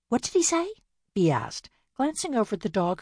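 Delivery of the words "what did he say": curious sister. Acted. Bea asks about the dog.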